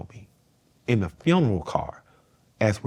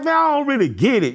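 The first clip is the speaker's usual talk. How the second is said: excited; 5 dB louder than usual. Retold or imitated. Imitating his young self, laughing.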